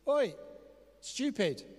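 high, sharp mock call; mock scolding. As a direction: call out rudely as a joke. What Jesus does not say, scolding.

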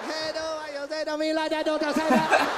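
mock Vietnamese accent zooming past; distant drive-by heckle in a Vietnamese accent; passing-car voice. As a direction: shout as if driving by fast, swelling as you pass. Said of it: "in a car far away".

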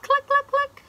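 high, loud staccato clucks; hen's clucking. Acted. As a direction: cluck like a busy hen. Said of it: "and the duck said".

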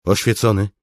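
high, loud urgent call. Acted. Soldier calls out to his enlightened commander, calling out. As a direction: hail the commander from afar.

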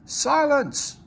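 loud, sharp command for silence; the Fat Controller barking silence; commanding order. A narrator's reading. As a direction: silence the quarrel at once. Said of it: "ordered a well-known voice".

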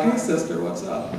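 a hint of playful camp lingo.

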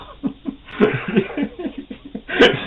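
high breathy burst of laughter. Real, not acted. Laughing at the memory.

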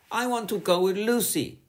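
sulky. A narrator's reading. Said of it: "sulked Sandra".

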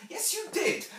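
put-on high, loud little-girl protest; insistent child. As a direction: contradict mother like a small child. Child Jane contradicts her mother.

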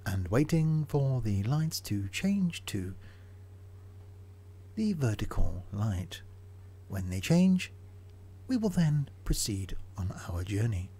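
slow, soft mock-formal narration; mock announcer. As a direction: narrate the wait like a solemn documentary.

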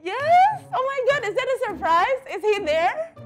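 high squealing surprise; excited squeal. Real, not acted. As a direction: shriek with delight. Surprise guest reveal, squealing.

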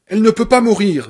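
loud furious cry, pitch up; shouting. Acted. Annie shouting at Paul in furious denial. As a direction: bellow it in disbelief. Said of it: "vociféra Annie Wilkes".

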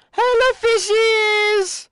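very high, slow, stretched-out squeaky hello; cutesy greeting. Pretend. Player greets fish in the water, cutesy greeting. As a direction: coo slowly in a tiny high voice.

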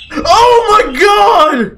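high, loud, drawn-out cry of disbelief; shocked scream. Real, not acted. Player just killed by a manticore, shocked scream. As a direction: scream it out slowly. Reacting to death in game.

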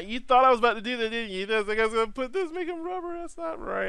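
very high, rushed squeaky voice; mock teasing. Teasing viewers who expected rubber springs, mock squeal. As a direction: tease them in a rapid falsetto.

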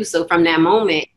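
ordinary talk, nothing marked

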